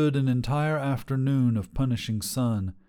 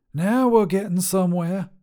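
higher, bold trucker woman voice; tough lady trucker; 8 semitones higher, 5 dB louder than usual. Acted. Trucker Sharon sizing up a hitchhiker, brash and higher. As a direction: call out like a brash long-haul trucker.

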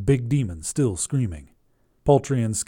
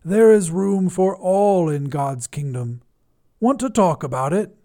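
priestly voice; 7 semitones higher, 4 dB louder than usual. A narrator's reading. Priest offers the demon God's kingdom, priestly intoning. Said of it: "he said in a priestly voice".

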